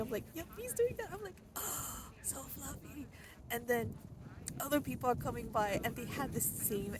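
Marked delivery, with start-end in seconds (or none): 0.3-1.1 delighted agreement. Retold or imitated. Dog leaning its weight on her. 1.6-3.1 cooing. Retold or imitated. Petting the fluffy dog.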